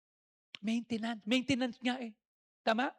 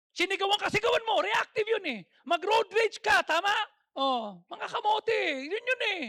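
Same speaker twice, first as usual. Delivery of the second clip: high, loud reactive outburst; mock yelling; 9 semitones higher, 6 dB louder than usual. Preacher showing reactive road rage, high and loud. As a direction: act out hot temper.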